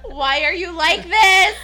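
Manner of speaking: high, loud, slowed, laughing disbelief; hammed-up exasperation through laughter; exasperated sister. Sister waking to find her on clothes, a loud, laughing mock-exasperation.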